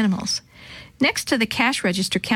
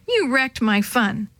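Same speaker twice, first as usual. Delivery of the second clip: ordinary delivery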